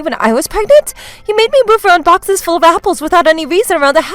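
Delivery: high-pitched indignant protest; shocked accusation. Acted. Daughter-in-law exposes mother-in-law to listeners, shocked accusation. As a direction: act appalled so everyone hears.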